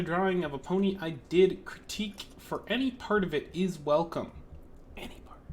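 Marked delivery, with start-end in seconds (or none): 5.0-5.4 soft whisper, unvoiced; whispered aside. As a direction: murmur it as a side note. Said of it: "whispered aside".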